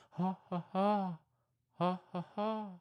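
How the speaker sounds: ha ha monkey hoots; monkey call. Pretend. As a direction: call out to your monkey brethren. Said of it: "You call out".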